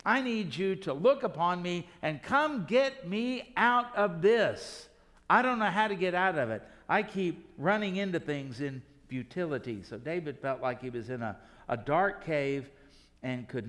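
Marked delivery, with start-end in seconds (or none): 0.0-9.8 pleading. Retold or imitated. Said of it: "David is saying".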